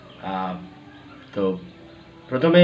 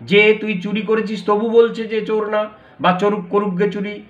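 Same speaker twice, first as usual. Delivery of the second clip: raised, higher accusing voice; berating thief; 7 semitones higher than usual. Acted. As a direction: scold the thief with rising outrage.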